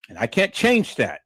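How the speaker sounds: ordinary talk, nothing marked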